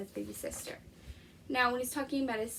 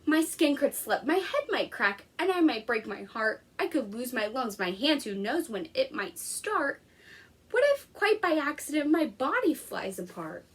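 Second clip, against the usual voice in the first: lively picture-book read-aloud; reading to children; 3 dB louder than usual. Acted. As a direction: read it to a class of young kids. Teacher reads picture book aloud.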